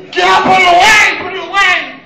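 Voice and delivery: high, loud, urgent yell; high, loud yell at the coworker to put it away; panicked shout. Retold or imitated. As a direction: shout it, desperate to make him stop. Walking in on coworker at urinal.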